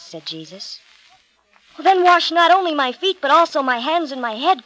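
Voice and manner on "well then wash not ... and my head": high, loud, impassioned plea; Peter crying out. A narrator's reading. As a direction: exclaim eagerly in a raised voice. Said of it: "cried Peter".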